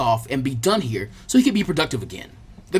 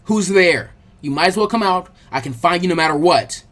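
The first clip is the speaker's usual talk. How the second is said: louder, challenging call into the trees; tough; 4 dB louder than usual. Acted. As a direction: sound tough to a hidden attacker. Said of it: "Naruto sounded pretty tough".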